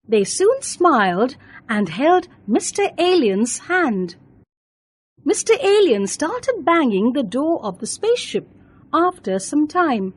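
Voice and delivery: ordinary delivery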